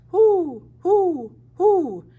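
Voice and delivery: high, loud hooting question; owl asking. A narrator's reading. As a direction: hoot it like a curious owl. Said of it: "the stranger asked".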